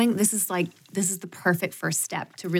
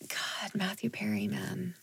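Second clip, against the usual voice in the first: hushed, softer whisper; 14 dB quieter, slower than usual. Real, not acted. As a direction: breathe it out quietly. Sad remark about actor.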